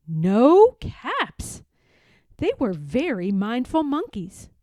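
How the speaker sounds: plain reading voice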